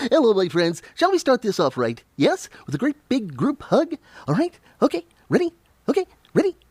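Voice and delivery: rally everyone for a pretend group hug; playful hug countdown. Streamer leading chat in a virtual group hug, playful and high.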